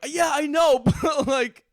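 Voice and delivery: high laughing shout; yelling. Retold or imitated. Yelling back at friend on the phone, laughing.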